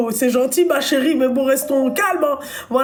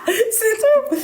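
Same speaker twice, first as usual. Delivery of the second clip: laughing; 11 semitones higher than usual. Real, not acted. Laughing at own joke.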